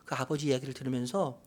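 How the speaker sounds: usual speaking voice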